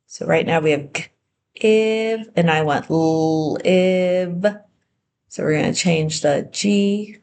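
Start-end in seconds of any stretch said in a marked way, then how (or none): 0.1-2.3 g-ive split, the g sound pulled apart from ive; sounding out words. Shown as a demonstration. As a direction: stretch out g-ive slowly. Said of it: "now turn give into live".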